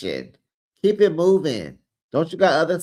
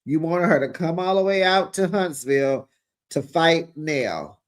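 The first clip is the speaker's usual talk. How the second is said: ordinary delivery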